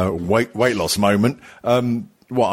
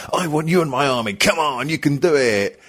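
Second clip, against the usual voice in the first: raised, rallying coach voice; rally the riders in a higher, put-on trainer voice; mock motivating instructor; 4 semitones higher than usual. Live-feed trainer urging on a rider from Plymouth, mock motivational. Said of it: "real motivating person saying".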